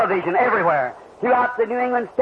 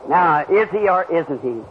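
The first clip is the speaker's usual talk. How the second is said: ordinary delivery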